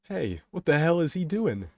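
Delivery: loud, high, incredulous outburst; startled thought. A narrator's reading. Kay watching Kato hop onto the tracks, startled thought. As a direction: blurt it in disbelief. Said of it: "Kay thinks to himself".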